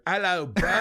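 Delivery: badly faked celebrity voice on purpose. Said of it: "it's gonna be a little off".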